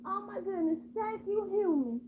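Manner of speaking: high, soft thankful cat voice; grateful cat. Acted. Cat thanks the human who saved it, grateful. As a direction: gush softly up high as a relieved cat.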